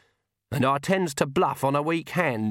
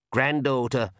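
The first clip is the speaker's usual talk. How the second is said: plain reading voice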